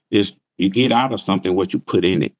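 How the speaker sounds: ordinary delivery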